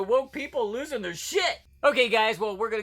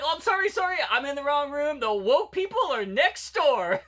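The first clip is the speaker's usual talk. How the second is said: higher-pitched mocking impression of a woman apologizing; mock apology; 7 semitones higher than usual. Woman realizing she's in the wrong room, mock apologetic. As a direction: act out an over-apologetic "wrong room" moment. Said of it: "And she'd be like".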